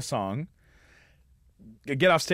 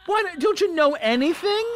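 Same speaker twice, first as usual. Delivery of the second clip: very high, screechy mock scolding; exasperated woman; 4 dB louder than usual. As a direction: sputter in hammed-up falsetto outrage. Lady scolds him, retold.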